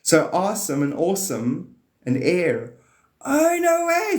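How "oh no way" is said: sudden delighted discovery; no way, squeaked in wonder; high surprised gasp. Real, not acted. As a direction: leap up high, amazed. Sudden realization about air awesome.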